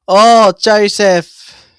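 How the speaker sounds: loud, drawn-out commentator's cry; excited exclamation. Real, not acted. Commentator reacts live to Joseph's delivery, excited. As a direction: exclaim it high and loud, stretching the words.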